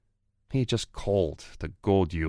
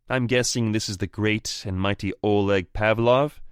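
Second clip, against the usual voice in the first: higher, louder sneering challenger; mock him with a cocky sneer; taunting rival voice; 5 semitones higher, 7 dB louder than usual. Anton's mocking voice on phone.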